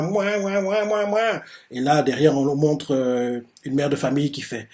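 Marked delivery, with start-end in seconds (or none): 0.0-1.4 mock baby wails, a touch higher; imitated baby crying. Frightened kids behind the wall, mock wailing. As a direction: play the crying toddlers. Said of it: "on voit les gamins qui pleurent".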